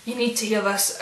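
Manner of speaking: ordinary talk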